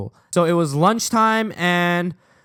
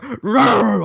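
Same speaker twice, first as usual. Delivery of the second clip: loud, higher mock growling roar; imitated bear roar; 6 semitones higher, 5 dB louder than usual. Two boys pushing each other like bears, mock roar. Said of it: "we're like bears just going".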